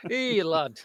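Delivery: gruff North Yorkshire greeting through a laugh; laughing imitation of a gruff North Yorkshire accent; Dennis Hopper North Yorkshire accent. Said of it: "a gruff North Yorkshire accent".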